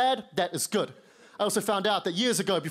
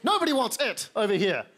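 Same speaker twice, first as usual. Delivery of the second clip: usual speaking voice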